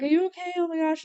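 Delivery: high, fussing, anxious voice; worried friends. Retold or imitated. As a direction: play the anxious friends fussing over her. Friends fussing over bloody nose.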